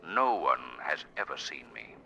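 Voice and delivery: low, soft ominous voice; mysterious unseen hero. Acted. Unseen hero announcing himself in the dark, low ominous voice. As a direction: speak from the darkness.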